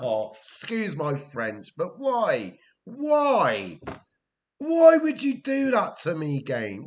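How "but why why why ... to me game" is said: rising from a sharp why to a full, higher shout; real frustrated outburst at the game; exasperated shout. Real, not acted. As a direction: yell at the game in disbelief. Manager rages at his game.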